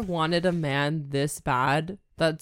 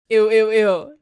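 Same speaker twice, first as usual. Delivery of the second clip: loud disgusted; 5 semitones higher, 10 dB louder than usual. Real, not acted. Disgusted by jolly belly.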